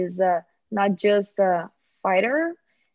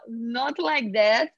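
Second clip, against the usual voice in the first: usual speaking voice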